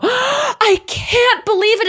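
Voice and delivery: high, slow, hammed-up awe with a gasp; a fan overwhelmed that a famous friend showed up; mock starstruck gasp. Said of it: "And we all just go".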